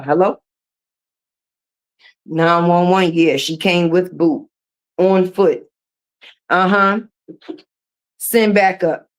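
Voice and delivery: low, mock-serious emergency call; a pretend call for backup about her giant boot; mock 911 call.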